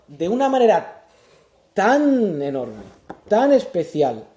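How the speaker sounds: loud, driving sermon voice; full-force preaching. Acted. Preacher urging the congregation on love, at full force. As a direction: preach it out to the whole church.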